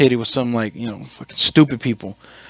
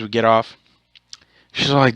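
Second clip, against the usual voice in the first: ordinary talk, nothing marked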